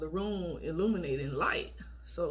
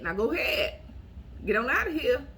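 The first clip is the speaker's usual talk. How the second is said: dismissive; 5 semitones higher, 4 dB louder than usual. Pretend. Shooing off a persistent man.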